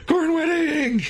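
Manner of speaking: very high, drawn-out cry; mock exclamation. Storyteller revealing the alien wedding, in a high hammed-up cry. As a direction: belt it out in hammed-up alarm.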